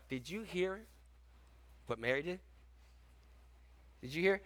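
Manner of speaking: soft, eager gossip murmur; gossipy hush. Retold or imitated. Passing gossip murmuring about Mary, soft and eager. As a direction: tease the rumour quietly.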